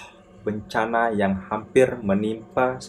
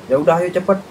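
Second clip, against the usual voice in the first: acted urgent hurrying; 6 semitones higher, 5 dB louder, faster than usual. Friend urges group to hurry down.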